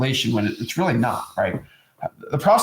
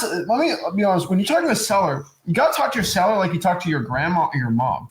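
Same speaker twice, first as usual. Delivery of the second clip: usual speaking voice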